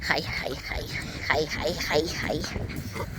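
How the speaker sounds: barely voiced, tender greetings; soft whispered hi. Real, not acted. Owner greets the new puppies in a soft whisper. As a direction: breathe the hellos softly.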